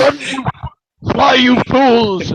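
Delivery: high, loud, drawn-out cry; dramatic warning shout. Acted. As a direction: yell a desperate warning to companions, hammed-up. Acts out famous warning shout while falling.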